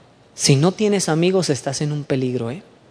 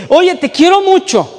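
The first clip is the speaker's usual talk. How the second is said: higher and louder, a fond declaration; affectionate friend; 14 semitones higher, 8 dB louder than usual. Retold or imitated. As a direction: say it like a friend who means it. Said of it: "otro me dice".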